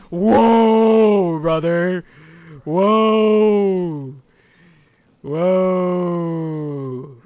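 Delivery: long, loud drawn-out whoa; big falling-away shout; alarmed holler. Real, not acted. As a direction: holler it as you slip away. Slipping and falling in game.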